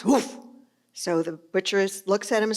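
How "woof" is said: short imitated dog woof; dog bark. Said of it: "The dog barks".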